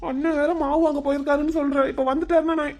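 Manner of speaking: high, nervous justifying; flustered panic. Acted. Young man fears brother returning to find girl, flustered. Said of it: "பின்ன பதறாம".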